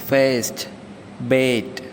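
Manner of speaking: ഫേസ്റ്റ് and ബേറ്റ് with a flat front a, as in cat; American flat a. Shown as a demonstration. Teacher demonstrating the American vowels in fast and bath. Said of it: "American ഇംഗ്ലീഷിലേക്ക് വരുമ്പോൾ".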